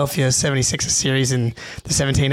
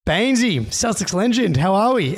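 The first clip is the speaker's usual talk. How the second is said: excited greeting; 6 semitones higher than usual. Retold or imitated. Fan greets his idol.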